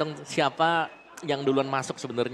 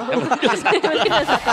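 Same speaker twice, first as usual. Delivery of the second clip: laughing; 11 semitones higher, 8 dB louder than usual. Real, not acted. Echoes partner while laughing.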